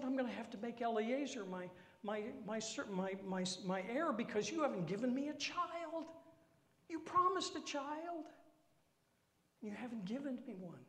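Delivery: high, fast, stammering whine; whiny complaint. Acted. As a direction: sputter it out like a sulking child. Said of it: "this kind of whiny complaint".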